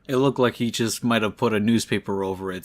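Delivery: ordinary delivery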